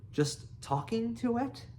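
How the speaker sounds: high, incredulous echo; astonished. A narrator's reading. Fish Legs echoes Hiccup's admission, astonished. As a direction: question it in disbelief, pitch leaping up. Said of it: "gasped Fish Legs in astonishment".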